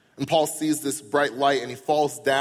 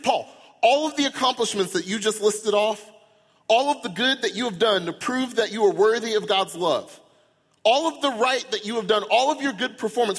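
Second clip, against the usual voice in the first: loud, high disbelief at Paul; mock outrage, voice up high and loud; incredulous; 8 semitones higher, 4 dB louder than usual. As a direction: challenge Paul as if he's lost his mind. Preacher mock-arguing with Paul.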